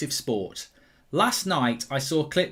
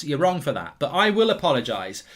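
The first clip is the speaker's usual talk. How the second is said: normal talking voice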